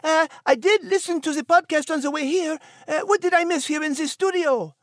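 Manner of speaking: breezy French greeting, high; French-accented character. Acted. Nigel greets his friends in the studio, high French accent.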